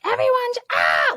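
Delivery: high, loud, imitated frazzled shriek at the kids; mock scream at kids. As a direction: act out losing it with the kids. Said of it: "You're screaming at your kids".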